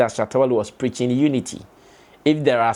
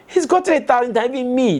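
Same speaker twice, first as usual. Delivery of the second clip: indignant burst; 10 semitones higher, faster than usual. Real, not acted. Indignant retort to critics.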